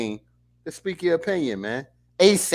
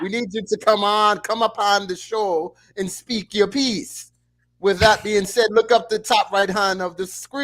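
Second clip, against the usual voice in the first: put-on Jamaican accent; 5 semitones higher than usual. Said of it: "he gonna do this whole part right here in Jamaican".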